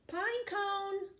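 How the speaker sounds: high, loud call across the forest. Acted. Penguin searching the forest for his friend, loud high call.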